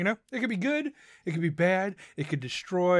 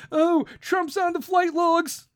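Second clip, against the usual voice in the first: mocking whine; 8 semitones higher, slower than usual. Mocking Democrats' claim.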